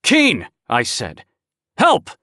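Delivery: high, loud call for help; urgent call. Acted. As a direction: shout to the partner from the study. Finds the body, calls partner.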